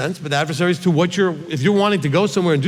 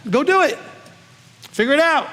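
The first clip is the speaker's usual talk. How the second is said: emphatic push; 10 semitones higher than usual. Acted. Urging listeners to act.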